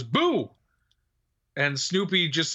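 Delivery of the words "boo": mild boo with a quick pitch swoop; a playful, half-hearted attempt to startle; lightly acted jump-scare. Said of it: "jumps out wearing a dog mask and goes".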